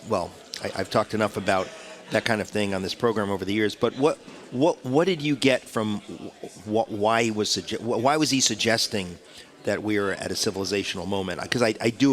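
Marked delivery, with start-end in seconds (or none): none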